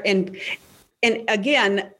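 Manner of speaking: usual speaking voice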